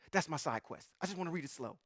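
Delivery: usual speaking voice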